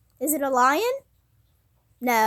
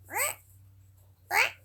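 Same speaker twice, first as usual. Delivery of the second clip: hedgehog call; 6 semitones higher than usual. Shown as a demonstration. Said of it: "The hedgehog goes".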